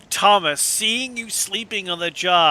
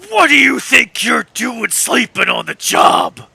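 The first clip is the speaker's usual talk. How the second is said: furious full-voiced shout, pitched up; loud, high angry outburst; angry old sailor; 6 semitones higher, 8 dB louder than usual. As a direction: bellow at the slacker caught sleeping.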